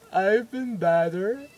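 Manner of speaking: mock timid kid voice; nervous patient. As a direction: answer haltingly, dreading the dentist. Frightened patient answers doctor.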